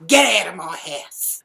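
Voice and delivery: slow, very loud order; angry shout. Retold or imitated. Ordering the housemate out while he dresses, angry shout. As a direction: bellow it, stretching the words.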